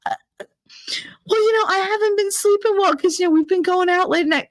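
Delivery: high, halting mock excuse; hesitant, whiny young woman; excuse-making girl. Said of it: "she was like".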